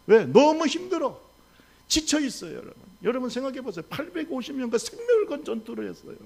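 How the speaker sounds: fervent, high preaching voice. Acted. Pastor urging the congregation, fervent preaching.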